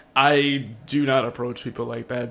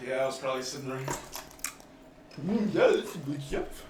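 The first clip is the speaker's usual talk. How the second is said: chewing gum; 7 dB quieter than usual. Real, not acted. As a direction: mouth full of gum. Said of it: "You sound like Timmy".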